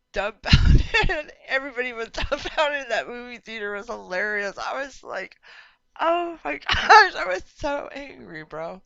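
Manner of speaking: loud, high, laughing retelling. Real, not acted. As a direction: crack up while telling it. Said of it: "I'm laughing".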